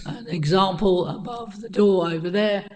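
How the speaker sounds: hoarse, croaky throat. Real, not acted. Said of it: "excuse my croaky voice this evening".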